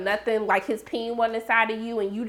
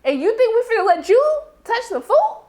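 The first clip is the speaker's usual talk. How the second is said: mock outraged refusal; 9 semitones higher than usual. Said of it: "and they was like".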